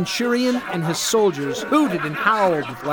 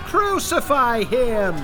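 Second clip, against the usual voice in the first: higher, slow drawn-out yell; crowd's yell; 7 semitones higher, slower than usual. Acted. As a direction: yell it like an angry mob. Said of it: "yelled the crowd".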